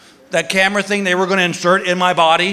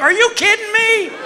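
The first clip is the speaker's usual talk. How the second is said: mock outrage; 11 semitones higher than usual. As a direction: squeal in comic disbelief. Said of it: "I'm like".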